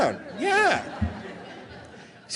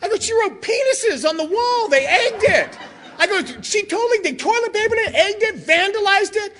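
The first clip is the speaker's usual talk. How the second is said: exasperated report; 9 semitones higher than usual. Retold or imitated. Telling mom about the vandalism.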